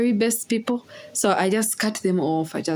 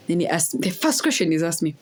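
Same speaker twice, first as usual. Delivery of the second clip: normal talking voice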